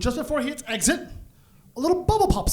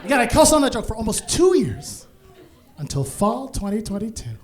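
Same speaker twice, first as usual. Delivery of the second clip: ordinary talk, nothing marked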